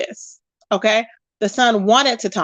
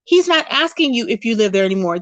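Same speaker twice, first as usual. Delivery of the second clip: normal talking voice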